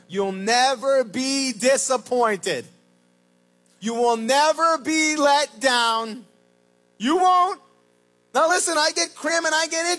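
fiery sermon build; preaching at full force. Acted. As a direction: drive the promise home.

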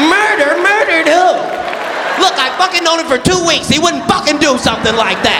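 high-pitched, incredulous protest. Retold or imitated. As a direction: imitate an outraged friend leaping to the defense. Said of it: "they'll be madder than you".

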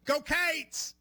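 "Go Cats" with Cats stretched into "Cates", Kentucky style; Kentucky drawl. Shown as a demonstration. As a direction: say "Cats" as one long Kentucky vowel, like "Cates". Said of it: "in Kentucky would go".

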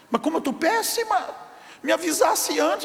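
high, indignant protest as the wife; indignant woman. Retold or imitated. (esposa indignada, voz bem mais aguda)

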